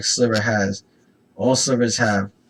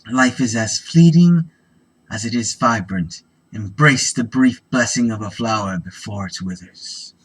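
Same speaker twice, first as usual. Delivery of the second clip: dramatic flavor reading; 6 dB louder than usual. Acted. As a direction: read it like solemn card lore. Reads flavor text aloud.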